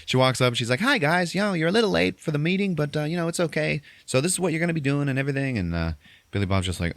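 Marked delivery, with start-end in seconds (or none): 0.8-5.6 higher, sing-song imitation of a woman, a bit fast; breezy lady greeting, pitched up; high mock lady voice. Retelling the woman greeting the late crew, high mock lady voice.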